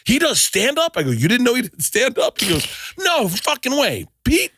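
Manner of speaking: very high, astonished squeal; amazed dad. Retold or imitated. Dad learning Pete does stand-up, high amazed squeal. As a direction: gasp it out like the news is incredible.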